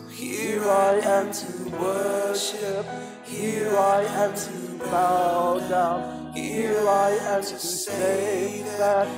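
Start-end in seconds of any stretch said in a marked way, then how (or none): none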